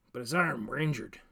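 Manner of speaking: ordinary delivery, nothing marked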